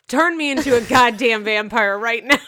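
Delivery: high, loud mock-desperate demand; hammed-up demand. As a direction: belt it out like an overexcited superfan. Hammed-up plea about loving Twilight.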